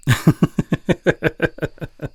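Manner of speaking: high, loud real laugh; burst of laughter. Real, not acted. Reading a silly bully line, real laughter. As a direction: crack up at the script. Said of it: "I'm not laughing".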